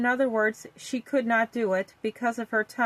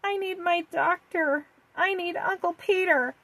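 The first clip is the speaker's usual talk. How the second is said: put-on little girl voice; 7 semitones higher than usual. A narrator's reading. Vicky asks for her doctor.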